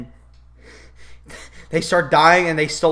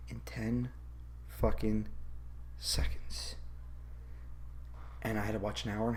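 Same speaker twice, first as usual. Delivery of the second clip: dropped to a whisper; 9 semitones lower, 19 dB quieter than usual. Real, not acted. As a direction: let each word fall into a hush. Dramatic drop after summing up plot.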